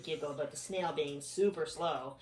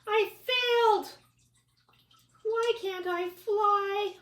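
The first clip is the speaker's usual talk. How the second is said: high bug voice; 8 dB louder, slower than usual. Acted. Bug character voice.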